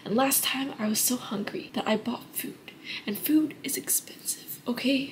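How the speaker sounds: secretive whisper. Real, not acted. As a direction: whisper it like a confession. Confiding aside about Michael's.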